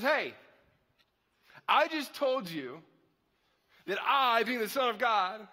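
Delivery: disbelieving teacher reining in his students; exasperated Jesus. Retold or imitated. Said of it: "Because Jesus just goes".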